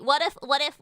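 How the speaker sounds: ordinary talk, nothing marked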